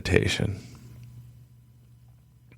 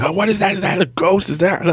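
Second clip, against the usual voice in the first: high, panicky inner voice; mock frightened mind. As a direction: voice a mind spooked by the dark, up high. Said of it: "your mind starts going".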